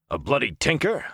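high, startled exclamation; exclaiming. A narrator's reading. Soldier reacts to a traveling tinker woman, exclaiming. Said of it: "Uno exclaimed".

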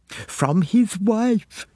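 put-on high, drawn-out girlish gasp. A narrator's reading. Girl finishing her friend's sentence, excited gasp. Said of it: "gasped Pamela Cregan".

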